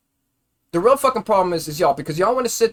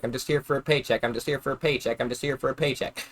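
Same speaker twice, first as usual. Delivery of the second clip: mocking, fading; 3 semitones lower, 5 dB quieter than usual. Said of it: "these days is".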